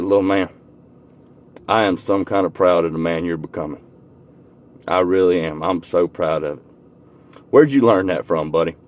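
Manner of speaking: low, soft and tender; hushed, proud, holding back emotion; tender pride. Retold or imitated. Uncle telling his nephew how proud he is, tenderly. Praising nephew, deeply moved.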